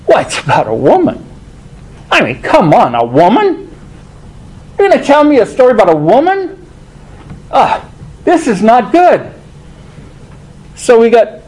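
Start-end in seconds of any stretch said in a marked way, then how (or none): 2.1-9.3 fast, higher scoffing question; quick, higher incredulous sneer; mock disbelief. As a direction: scoff like a Pharisee hearing about a woman. Voicing the Pharisees' scorn.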